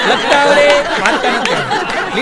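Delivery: loud, laughing repeat of the joke; laughing punchline. Acted. Punchline mock of illiterate.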